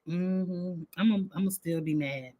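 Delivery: a quick low grumble of still be mad; murmured aside, as if to herself. Real, not acted. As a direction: drop the volume and rush it under her breath. Repeats that she'll stay mad.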